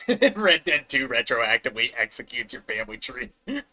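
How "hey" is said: ordinary talk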